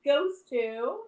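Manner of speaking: winner announcement. Acted.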